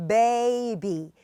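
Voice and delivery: 베이비 stretched slowly as two syllables, BAY-bee; 베이비 in two slow beats, stress on the first; slow two-syllable baby. Shown as a demonstration. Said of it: "두 개의 음절이기 때문에".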